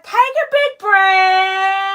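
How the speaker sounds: last word held on and on; slow, with a hugely sustained ending; long drawn-out. Real, not acted. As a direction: stretch "breath" out in one long steady note. Drawn-out final word on stream.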